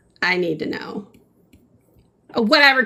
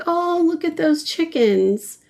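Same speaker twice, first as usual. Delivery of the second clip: higher, slow cooing delight; mock sweet coo; 9 semitones higher than usual. Retelling watching the chicken video, higher slow coo. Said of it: "I was like".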